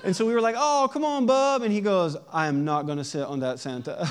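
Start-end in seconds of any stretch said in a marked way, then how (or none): 0.6-1.6 high, loud mock coaxing; coaxing parent. Parents urge their son toward Santa, high coaxing voice. 2.3-4.1 low, stubborn imitation of a small boy; little boy refusing. Dad imitates his son refusing Santa, low and firm. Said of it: "just a little bitty guy".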